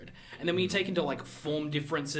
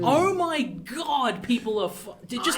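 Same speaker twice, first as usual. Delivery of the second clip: mock exasperation; 7 semitones higher, 4 dB louder, slower than usual. Mimicking the internet's outrage.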